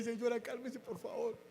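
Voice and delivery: soft plea. Retold or imitated. Pleading softly with angry lady.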